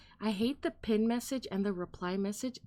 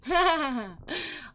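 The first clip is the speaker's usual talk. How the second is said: high cackling laugh; loud high laugh; 7 semitones higher, 5 dB louder than usual. Real, not acted. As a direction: burst out laughing. Laughing at the song.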